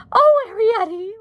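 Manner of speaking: high, loud wail. A narrator's reading. Mother reproaches her daughter, wailing. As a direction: cry out as if in despair. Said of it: "wailed Homley suddenly".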